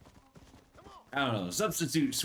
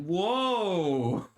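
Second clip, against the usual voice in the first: loud, high, drawn-out whoa; mock shock; 8 semitones higher, 6 dB louder than usual. Reacting to a friend's substitute swear, mock shock. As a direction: react in exaggerated astonishment.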